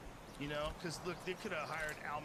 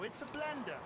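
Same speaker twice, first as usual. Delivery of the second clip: mock British pitchman, pitched higher; British salesman accent; 10 semitones higher than usual. Mocking late-night British salesman, British accent. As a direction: sell it like a British infomercial host in a hammed-up accent. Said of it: "They have a British accent".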